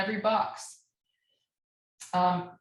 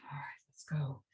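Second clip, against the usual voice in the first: whisper; 5 semitones lower, 11 dB quieter than usual. Real, not acted. Teacher whispers aside to self.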